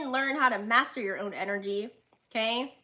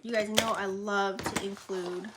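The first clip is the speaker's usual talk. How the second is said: usual speaking voice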